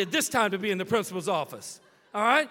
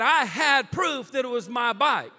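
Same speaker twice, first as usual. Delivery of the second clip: louder, rising in triumph; triumphant shout; 4 semitones higher, 8 dB louder than usual. Real, not acted. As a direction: nail the point home with a shout. Triumphant point in story.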